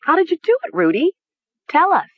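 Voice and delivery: very high, urgent questioning; frantic cry. A narrator's reading. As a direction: demand an answer, pitch leaping up. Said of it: "she cried".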